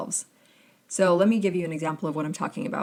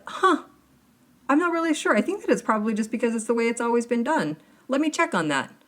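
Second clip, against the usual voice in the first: puzzled agency man; 5 semitones higher, faster than usual. Retold or imitated. Clueless agency man retold.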